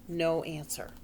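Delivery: plain reading voice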